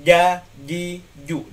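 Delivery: teacher drills the jim letter; strong Arabic jim. Shown as a demonstration. Teacher drilling jim for students, pressed firm j. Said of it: "J, cuman lebih kuat".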